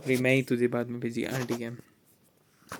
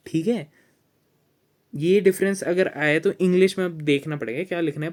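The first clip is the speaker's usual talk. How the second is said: raised, louder voice explaining return vs print; 3 semitones higher, 6 dB louder than usual. Real, not acted. Friend explaining loudly over a call, raised voice. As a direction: project it hard like a fired-up tutor. Loud explaining, mom complains later.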